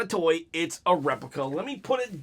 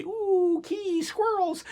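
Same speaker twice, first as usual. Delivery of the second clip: much higher, slow, sudden distraction; distracted squirrel voice; 14 semitones higher, slower than usual. Vlogger joking about his ADHD, high distracted squeak. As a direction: jump way up like a squirrel caught your eye. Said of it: "I have A D H D, by the way".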